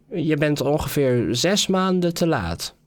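king tells the latecomer off, irritated. A narrator's reading. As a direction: scold him for being late. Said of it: "Geërgerd".

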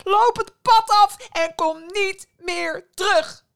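high, full-volume furious shouting; screaming tirade, banishing her son; enraged mother. A narrator's reading. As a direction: hurl insults with slow, hammered words.

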